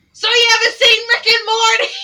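high, blasting shouted question; full-volume mimicked kid; imitated kid's yell. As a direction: holler it like an unfiltered schoolkid. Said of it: "yell at the top of his lungs".

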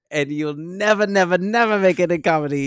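loud, high mocking parent voice; quoting a harsh parent. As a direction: boom it like a scornful parent telling her she'll fail. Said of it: "it cracked me up when she goes".

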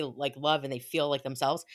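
ordinary delivery

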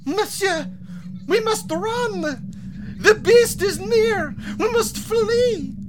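put-on high, slow, crying French girl; panicked French girl. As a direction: beg him to flee, terrified. Girl fleeing the beast.

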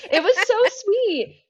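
high, gushing repeat of sweet; touched, swooning repeat. Real, not acted. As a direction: gush over how sweet it was.

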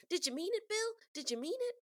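high, pleading questions; mock heroine pitched well up; Calamity impression. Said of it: "she's like".

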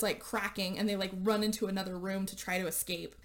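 ordinary delivery

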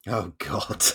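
normal talking voice

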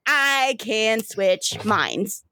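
very slow, savored words; drawn-out boast. Acted. Sister showing off her mind switch, slow and drawn out. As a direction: stretch each word out proudly. River gleefully shows off her power.

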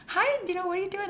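high, sweet phone greeting; sweet grandma voice. Retold or imitated. Grandma answering the phone sweetly, high voice. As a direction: lift it like a kind old grandma. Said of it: "she was so sweet on the phone".

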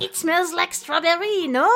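a cheesy French lilt, ending on a question; mock French accent. Trapper offering the smell to his friend, in a mock French accent. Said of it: "Your accents are so fun to do".

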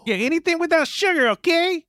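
put-on squeaky kid begging at a candy store. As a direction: play an eager little boy asking for candy. Child role in improv skit.

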